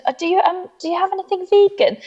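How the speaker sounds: high, tentative polite request; eager to please. Retold or imitated. Customer nervously asking the cafe for vegan food, eager to please. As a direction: ask apologetically in a high voice. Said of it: "my, um, need to please".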